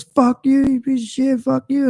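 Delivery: high, mocking road-rage driver voice; angry driver. Mocking the road-rage driver yelling through the window. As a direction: mimic the raging driver squealing at you. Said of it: "And he goes".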